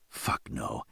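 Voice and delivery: hushed, breathy whisper under the breath; whispered curse. A narrator's reading. Man seeing her bruises swears to himself in a whisper. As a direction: breathe the curse out, barely voiced. His horrified inner reaction.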